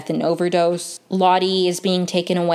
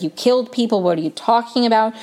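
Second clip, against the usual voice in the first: retold freak-out; 4 semitones higher, faster than usual. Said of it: "freaking out".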